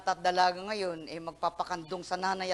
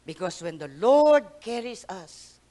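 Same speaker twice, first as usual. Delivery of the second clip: ordinary talk, nothing marked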